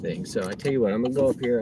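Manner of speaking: ordinary delivery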